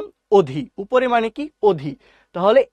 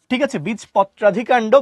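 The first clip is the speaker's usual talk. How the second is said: ordinary talk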